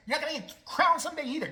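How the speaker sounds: ordinary talk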